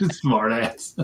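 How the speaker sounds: chuckling through the words; laughing. Real, not acted. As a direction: laugh while saying it. Laughing at a joke.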